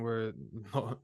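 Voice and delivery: usual speaking voice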